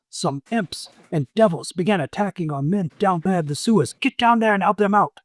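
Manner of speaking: a hint of a bugbear boss; bugbear orders. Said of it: "The bugbear says".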